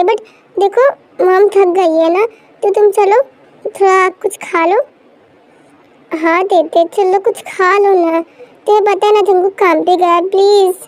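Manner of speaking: usual speaking voice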